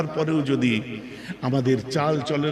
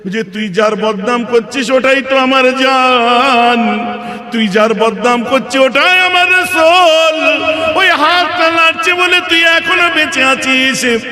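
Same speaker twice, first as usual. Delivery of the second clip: high, loud chant with stretched endings; slow, soaring declamation in the role of Bilal; full-force impassioned preaching; 13 semitones higher, 12 dB louder, slower than usual. Acted. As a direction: cry it out as a chanted climax. Acts Bilal's outburst, chanted.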